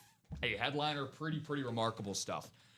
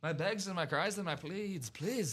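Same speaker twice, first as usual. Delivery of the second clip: higher, put-on Irish pleading; Irish-sounding accent; 4 semitones higher than usual. Host replaying his own plea, put-on Irish accent. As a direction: plead with a playful Irish lilt. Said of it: "Sounds like he was playing in Dublin".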